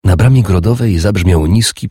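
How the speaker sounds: plain reading voice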